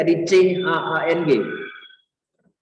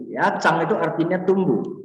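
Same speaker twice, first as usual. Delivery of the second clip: normal talking voice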